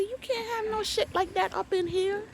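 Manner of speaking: high scolding protest; scolding disbelief. Retold or imitated. Scolding the neighbor about the snake, high and indignant. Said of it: "I said".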